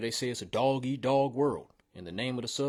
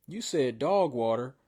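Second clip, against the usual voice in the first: usual speaking voice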